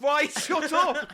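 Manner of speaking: high, drawn-out cry of protest; exasperated shout. Real, not acted. Host cutting off a crude co-host, exasperated shout. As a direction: shut the other person up loudly. Cuts off co-host's crude joke.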